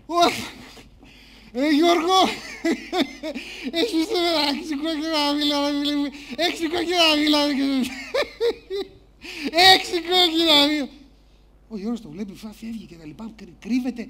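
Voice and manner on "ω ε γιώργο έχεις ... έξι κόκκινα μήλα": mocking drinker laughing through the words; homeless drinker. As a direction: jeer and cackle like a street drinker. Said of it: "άστεγος εκεί πέρα με ένα μπουκάλι".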